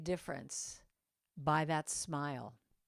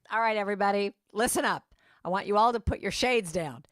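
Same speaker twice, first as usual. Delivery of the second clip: loud cabin announcement, imitating a flight attendant; commanding; 6 dB louder than usual. Flight attendant ordering everyone on the plane, commanding. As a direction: call the whole cabin to order. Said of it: "the way she said it was so commanding".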